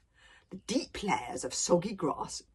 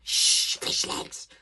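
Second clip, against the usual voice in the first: urgent hushing whisper; much slower than usual. Acted. Hiccup digs his friend in the ribs, urgent whisper. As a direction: shush a friend desperately under your breath. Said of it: "whispered Hiccup".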